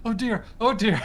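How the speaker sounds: worried healer rushing over; fretful. Retold or imitated. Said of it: "I'm running over being like".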